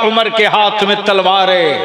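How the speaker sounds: ordinary delivery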